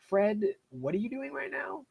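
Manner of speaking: high, baffled, teasing; incredulous mock question. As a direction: ask it like the writing makes no sense. Mocking the game's writing as adult.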